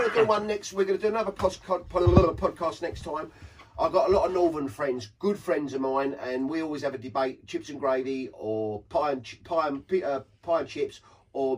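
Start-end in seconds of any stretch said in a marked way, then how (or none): none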